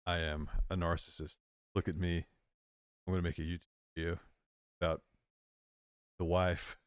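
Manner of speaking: low, soft, halting delivery; H G Tudor imitation. Mimics H G Tudor's speech.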